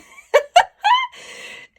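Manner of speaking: high, loud peals of laughter; helpless laughter. Real, not acted. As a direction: keep cracking up, high and loud. Laughing at the fabric.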